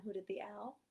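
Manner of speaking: usual speaking voice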